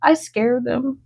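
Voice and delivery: quiet, mock-sheepish admission; sheepish confession. Prankster owning up to the neighbor, quiet sheepish voice. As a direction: imitate him owning up softly.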